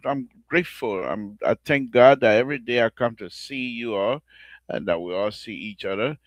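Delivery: normal talking voice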